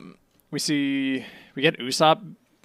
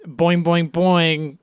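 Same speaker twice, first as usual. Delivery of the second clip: boing sound effect; 6 semitones higher, 6 dB louder, much slower than usual. Retold or imitated. Imitated cartoon sound.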